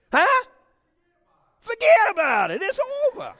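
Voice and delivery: "Huh? Forget about it. It's over" thrown out high and loud; playful loud exclamation. Real, not acted. Preacher playing to the congregation, with a loud mock tough-guy exclamation.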